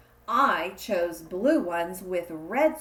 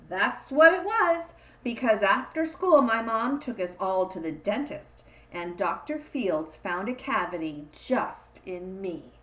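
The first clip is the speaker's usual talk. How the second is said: ordinary delivery, nothing marked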